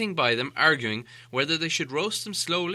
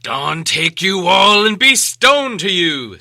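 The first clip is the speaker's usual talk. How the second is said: slow, loud booming curse; triumphant curse; 5 semitones higher, 13 dB louder, slower than usual. Acted. Gandalf cursing the trolls at dawn, loud slow boom. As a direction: call down the dawn on them. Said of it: "said a voice that sounded like William's".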